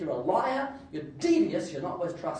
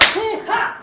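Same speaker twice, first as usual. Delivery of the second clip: loud, high preacher's grunt and "ha!"; fired-up preacher's "ha!"; 12 dB louder than usual. Shown as a demonstration. Preacher showing congregation how to prod people, explosive "ha!". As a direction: burst out a punchy pulpit "ha!". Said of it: "preacher's got a little bit of".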